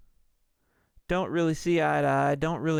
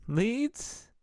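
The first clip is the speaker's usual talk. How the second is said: "Leads?" pitched much higher with an incredulous rise; mocking disbelief; 9 semitones higher than usual. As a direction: echo "Leads?" high, as if it were absurd. Cop mocks the dude's question.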